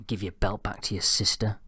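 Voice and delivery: ordinary delivery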